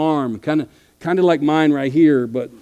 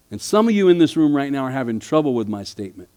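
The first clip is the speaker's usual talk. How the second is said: normal talking voice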